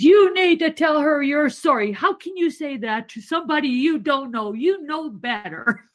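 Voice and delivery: a retold grandma demanding an apology; scolding grandma.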